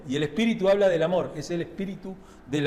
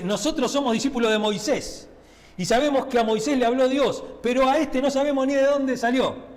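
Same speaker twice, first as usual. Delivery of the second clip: scornful Pharisees; 7 semitones higher than usual. Acted. Pharisees scornfully rejecting Jesus.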